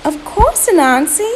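high, loud, obliging reply; polite Miss Ganea Fowl. A narrator's reading. Polite mother fowl agreeing to help the spider, high. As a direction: chirp a courteous agreement. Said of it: "the polite Miss Ganea Fowl".

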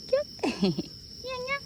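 put-on very high cutesy baby sound; baby talk. Owner cooing baby talk to the dog, very high.

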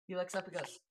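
normal talking voice

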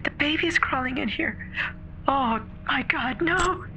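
put-on scream-cry as the baby crawls in; hysterical terror.

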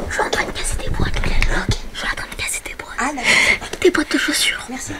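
hushed whisper in hiding; whispering while hiding. Real, not acted. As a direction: whisper so they won't hear.

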